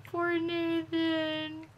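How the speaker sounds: ordinary talk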